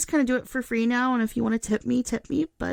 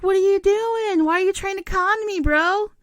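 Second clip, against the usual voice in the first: high, loud outraged demand; indignant yell; 9 semitones higher, 6 dB louder than usual. Retold or imitated. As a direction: yell it as if caught being conned. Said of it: "And I'm like".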